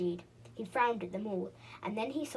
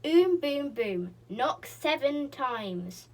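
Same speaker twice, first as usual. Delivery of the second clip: slow booming sign; 4 semitones higher, 7 dB louder, much slower than usual. Acted. Reading the pixie's door sign in a slow booming voice. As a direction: boom out every word of the sign slowly.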